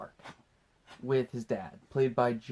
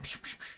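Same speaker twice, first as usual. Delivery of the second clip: whispered psh psh gun sounds; breathy mock gun shots; squirt gun noises; 17 dB quieter than usual. Kid playing, mimicking squirt gun shots, whispered.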